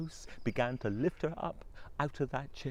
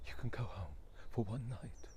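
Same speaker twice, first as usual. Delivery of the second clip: soft unvoiced eerie whisper; ghost voice; 5 semitones lower, 6 dB quieter than usual. The ghosts whispering their terms to the wife. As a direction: hiss it like a ghost.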